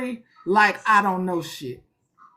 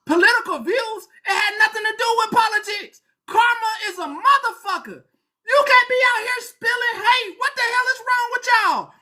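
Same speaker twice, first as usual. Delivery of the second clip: high, fast, furious tirade; heated rant; 11 semitones higher than usual. Real, not acted. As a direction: vent the anger at full pitch. Ranting angrily about the hate.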